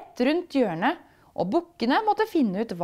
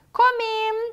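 high bright call toward the door; 13 semitones higher, 4 dB louder than usual. Acted. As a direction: call them in from across the room. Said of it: "roper pulverheksa".

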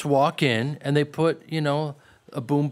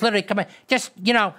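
mock Pesci direction; 5 semitones higher than usual. Pesci voiced directing the scene.

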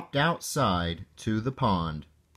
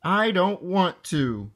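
whining; 7 semitones higher than usual. A narrator's reading. Froggy balking at his mother's swim lesson, whining. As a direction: whine it like a reluctant kid. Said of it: "whined Froggy".

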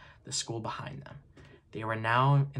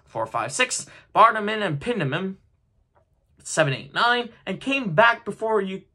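brisk, raised, excited telling; lively story narration; 6 semitones higher, 4 dB louder than usual. A narrator's reading. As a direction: tell it with gathering bustle.

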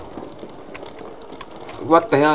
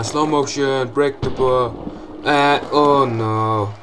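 ordinary talk, nothing marked

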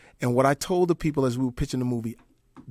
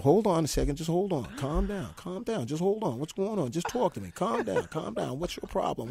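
soft calming words; quiet firm calming voice; 2 semitones higher, 7 dB quieter than usual. Retold or imitated. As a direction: settle him down quietly. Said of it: "He said".

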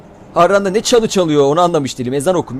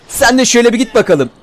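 higher teasing push-off; mocking send-off; 7 semitones higher, 3 dB louder than usual. Real, not acted. Gamer shoving a zombie off, mocking and higher. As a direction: tease him as you shove him away.

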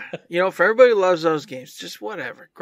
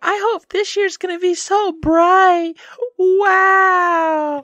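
mock cheery voice; 4 dB louder, slower than usual. Mocking past self's cheerful voice.

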